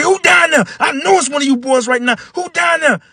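put-on high, loud angry old man shouting. Old man catching the boys, angry high shout. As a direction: yell down the stairs like a furious old man.

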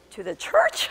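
very high, squeaky mock puzzlement; feigned surprise. Preacher revealing whom the warning addresses, high-pitched feigned surprise. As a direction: lift it far up as if baffled.

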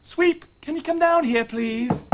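restrained, courteous summons; corrected, polite call. Acted. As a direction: rein the voice in and ask nicely.

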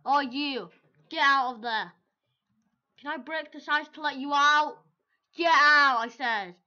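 loud, high bossy yell; shouting at the screen. Real, not acted. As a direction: order the character out, loudly. Yelling at trapped character.

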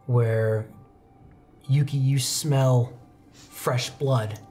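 normal talking voice

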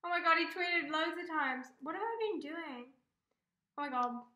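loud, high fan outburst; overwhelmed fangirl gasp; excited outburst. Real, not acted. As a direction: blurt it out in shock. Fan reacting live to tweets.

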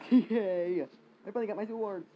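high delighted cheer; excited cheer. Real, not acted. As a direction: celebrate loudly. Finally gets swords.

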